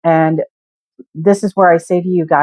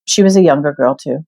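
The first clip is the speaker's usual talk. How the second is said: ordinary talk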